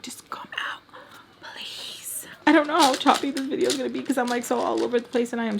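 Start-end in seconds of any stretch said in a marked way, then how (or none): 0.0-2.3 hushed, whispered begging; pleading whisper. Acted. Vlogger begging her other self to come out, pleading whisper. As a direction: drop into a whispered plea.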